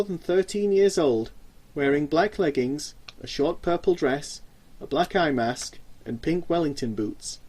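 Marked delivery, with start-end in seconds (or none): none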